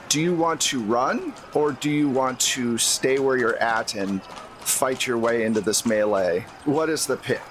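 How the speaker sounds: ordinary talk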